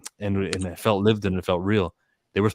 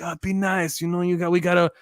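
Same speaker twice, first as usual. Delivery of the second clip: higher, soothing voice smoothing things over; coaxing peacemaker; 8 semitones higher than usual. Retold or imitated. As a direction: play the gentle go-between calming her down. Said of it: "He was kinda playing that role".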